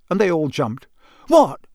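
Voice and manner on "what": high, loud, too-innocent reply; falsely innocent. A narrator's reading. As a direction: feign innocence and fool nobody. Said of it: "in an innocent tone that deceived nobody".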